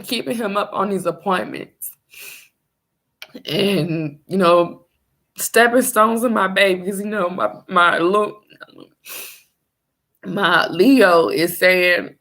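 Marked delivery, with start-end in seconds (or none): none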